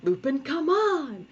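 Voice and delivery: high, drawn-out scolding cry; all-caps exasperation. Shown as a demonstration. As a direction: whine at the misbehaving cat in comic capitals. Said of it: "voice that you sometimes see in the comic when I'm in all caps".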